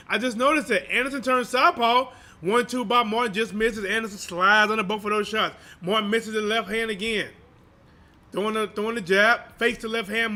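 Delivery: live play-by-play. Acted.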